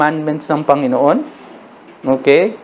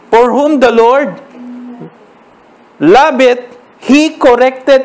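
louder, higher and slow, every word weighted; proclaimed scripture; 6 semitones higher, 3 dB louder, much slower than usual. Acted. Pastor proclaiming the verse loudly and slowly to the congregation.

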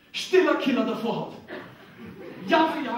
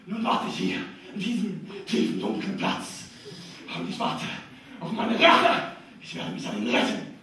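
lower, dark vengeful voice; opera villain; 4 semitones lower than usual. Acted. Comedian voicing the penis as opera phantom, dark and vengeful. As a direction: brood like a phantom in his lair.

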